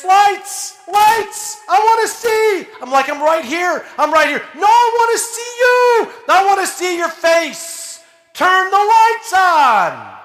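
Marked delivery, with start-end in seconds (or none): 0.0-2.7 put-on high, loud panicked little boy; terrified boy crying out in the dark; frightened child's scream. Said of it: "he screamed. And he said this". 3.1-4.4 calling reassurance. Retold or imitated. Dad comforting screaming son. 4.5-10.2 put-on high, loud panicked little boy; terrified boy crying out in the dark; frightened child's scream. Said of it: "he screamed".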